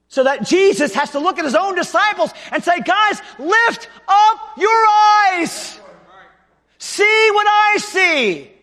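shouted, high-pitched preaching climax; fiery preaching. Acted. Pastor urging the congregation to see lost souls, fiery shouted preaching. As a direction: preach it at full force, pleading.

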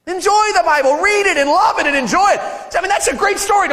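high, loud fervent push; preaching shout. Real, not acted. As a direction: drive it home to the crowd. Preacher urging the crowd.

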